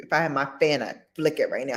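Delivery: normal talking voice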